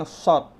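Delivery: "sod" with pouted lips, dark s; emphatic rounded "sod". Shown as a demonstration. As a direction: push lips forward on "sod". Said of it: "mulutnya monyong".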